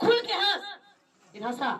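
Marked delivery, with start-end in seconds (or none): 0.1-0.6 urging shout. Retold or imitated. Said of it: "कहता".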